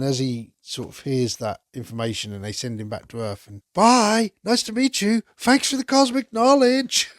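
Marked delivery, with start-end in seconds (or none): 3.7-7.1 much higher, louder cartoon voice; goofy Gonzo farewell. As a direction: chirp a cheery goodbye, way up high. Goofy farewell to space fish.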